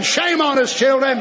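usual speaking voice

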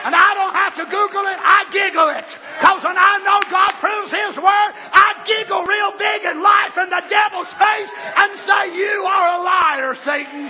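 shouted, high exultant preaching; fiery preaching. Acted. Preacher shouting to congregation, exultant and loud. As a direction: cry it out in triumph.

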